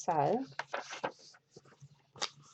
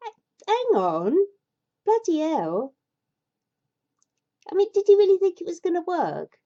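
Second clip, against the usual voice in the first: higher and louder, exasperated at the story; exasperated outburst; 10 semitones higher, 6 dB louder than usual. Real, not acted. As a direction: blurt it out in disbelief. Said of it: "exasperated outburst at story".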